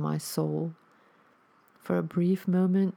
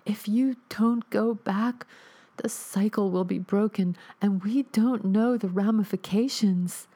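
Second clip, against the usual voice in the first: pleading ghostly mother; 5 semitones higher than usual. Acted. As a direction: press the warning on him. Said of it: "soft and delicate".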